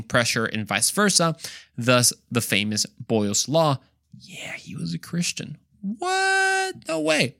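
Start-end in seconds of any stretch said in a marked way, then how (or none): 4.2-5.6 hushed, whispered reveal; playful whisper. Pretend. Mock-secret reveal of a fact. 5.8-7.3 high, squealed mock disbelief; hammed-up high-pitched shock; mock amazement.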